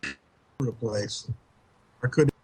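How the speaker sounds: ordinary delivery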